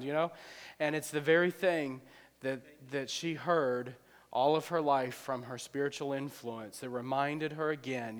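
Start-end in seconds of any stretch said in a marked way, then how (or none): none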